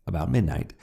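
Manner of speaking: ordinary delivery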